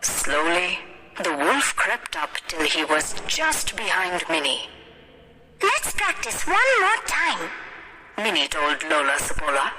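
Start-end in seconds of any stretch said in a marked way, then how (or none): 5.6-7.5 put-on high, eager girl; high girlish storybook voice; little girl character. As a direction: proudly declare it in a high child's voice.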